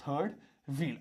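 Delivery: "third... wheel", a pause between the words. Shown as a demonstration. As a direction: dictate "third wheel" one word at a time. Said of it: "ऐसे लिखा हो". ("third wheel" को अलग-अलग शब्दों में बोलें)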